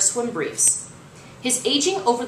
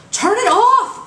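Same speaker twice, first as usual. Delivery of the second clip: much higher, much louder panicked scream; 9 dB louder, slower than usual. Acted. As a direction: scream it at the booth. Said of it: "Coach Creed screamed".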